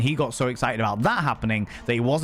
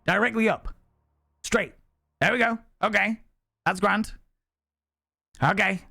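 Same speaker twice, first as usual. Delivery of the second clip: coaching instructions; 9 semitones higher than usual. Retold or imitated. Coaching child up on ropes.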